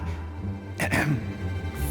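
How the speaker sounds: hesitant clearing of the throat. Acted. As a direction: clear the throat before speaking up. Hesitant underling before questioning orders.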